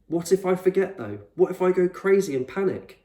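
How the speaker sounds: put-on a frightened kid blurting out his worst fear; anxious boy. Frightened boy pleads with explorer.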